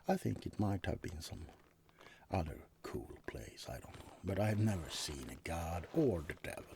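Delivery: normal talking voice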